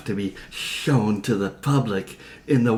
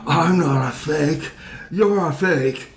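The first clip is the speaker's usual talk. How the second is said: put-on loud, high drunken protest; 6 semitones higher, 5 dB louder than usual. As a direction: shout it like a drunk denying it. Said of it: "in the same drunken voice".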